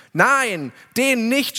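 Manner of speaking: laut und höher zurückrufen; geschrienes Nein. Retold or imitated. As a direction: als aufgebrachte Menge dagegenrufen. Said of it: "schrien sie zurück". (die Menge schreit Pilatus ihr Nein entgegen)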